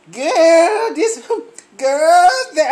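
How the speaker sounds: put-on high, laughing girl talk; mock gossiping women. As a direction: mimic the chattering girls. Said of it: "y'all walking down".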